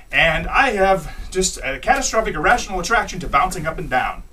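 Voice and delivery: usual speaking voice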